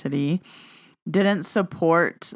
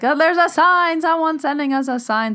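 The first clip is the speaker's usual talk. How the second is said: excited; 10 semitones higher, 4 dB louder than usual. Retold or imitated. Excitedly explaining the sign.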